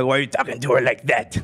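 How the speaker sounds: quick, croaking sinister villain impression; the Emperor. Drunk guy disguising his voice on the phone, villain impression. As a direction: do an evil old overlord. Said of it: "I start imitating the Emperor from Star Wars".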